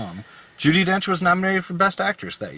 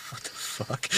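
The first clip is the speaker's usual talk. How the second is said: whispered; 14 dB quieter, slower than usual. Retold or imitated. Manager shaking his head, muttering.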